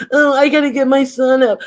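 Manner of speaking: hammed-up high-pitched mimicry; mocking quote. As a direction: toss it off in her flustered voice. Mocking M U's excuse.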